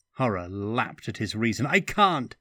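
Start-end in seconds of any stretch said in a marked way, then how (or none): 1.7-2.4 higher and louder, in dread; desperate cry. A narrator's reading. Stefan refusing Eberhauer's lesson, a desperate cry. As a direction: beg off, frightened.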